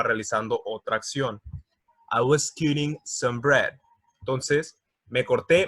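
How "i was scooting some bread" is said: slow, careful English example; slow reading. Shown as a demonstration. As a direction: read it slowly, word by word. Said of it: "reading example slowly".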